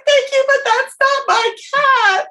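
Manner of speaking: very high, slow blubbering wail; hammed-up crying through a polite thanks; mock bawling. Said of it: "I'm, like, bawling and calling".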